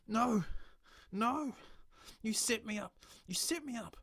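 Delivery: high, loud frantic cries; panicked accusation. Acted. Stranger crying out that the bartender set him up. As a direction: panic as the trap closes.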